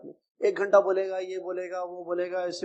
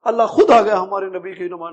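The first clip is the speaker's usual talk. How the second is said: normal talking voice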